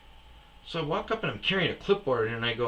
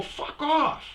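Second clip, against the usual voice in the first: much higher snapped retort; angry dismissal. Retold or imitated. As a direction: cut him off. Telling driver off.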